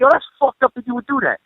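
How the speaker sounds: high, mock-indignant reproach; guilt trip. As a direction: lay it on as if betrayed. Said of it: "I laid such a guilt trip".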